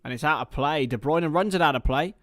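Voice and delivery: building match commentary, rising into the kick-off; live play-by-play. Acted.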